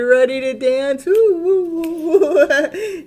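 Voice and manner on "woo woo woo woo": high, loud laughing woo-woo; excited whooping. Real, not acted. Tarot reader hyping her dog to dance, laughing whoops.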